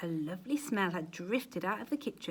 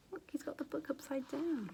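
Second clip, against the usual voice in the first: whisper; 6 semitones higher, 8 dB quieter than usual. Real, not acted. Aside whisper to child listener.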